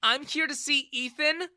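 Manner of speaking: high, loud, cross retort; indignant daughter's reply; angry. Acted. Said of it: "she replied angrily".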